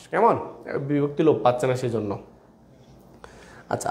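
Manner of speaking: ordinary talk, nothing marked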